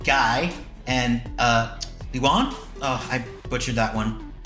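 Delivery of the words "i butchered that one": normal talking voice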